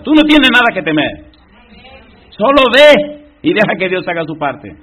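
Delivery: high, loud, emphatic preacher; preaching at full force. Real, not acted. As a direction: drive each phrase home to the congregation.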